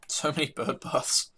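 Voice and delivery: slow words through a yawn. Real, not acted. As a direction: talk mid-yawn. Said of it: "sorry about the yawning".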